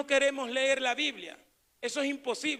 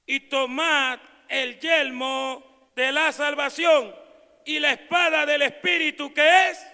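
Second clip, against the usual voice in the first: preached scripture; 3 semitones higher, 8 dB louder, slower than usual. Acted.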